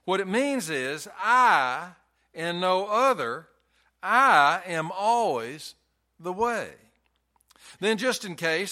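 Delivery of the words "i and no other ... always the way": slow, loud, each word weighted; slow emphatic I. Shown as a demonstration. Preacher insisting to the congregation, slow and emphatic. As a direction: drive home that it is I alone. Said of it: "I is emphatic".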